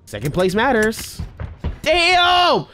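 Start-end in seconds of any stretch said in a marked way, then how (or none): 1.8-2.7 sharp high yelp of frustration; startled shout. Real, not acted. Gamer yelling at his own loss, sharp damn. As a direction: blurt it out, caught off guard.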